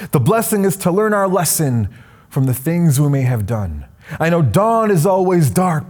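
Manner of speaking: poem recitation. Acted. Speaker reciting her own poem to graduating students, spoken-word style. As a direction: perform the poem like a stage recital.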